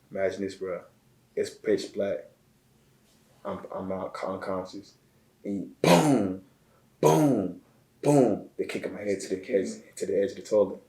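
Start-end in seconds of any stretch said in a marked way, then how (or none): 5.8-8.5 booming thuds, each drawn out and falling; loud, falling impact noises; imitated blows. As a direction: punch out each boom like a blow landing. Imitating blows to his head.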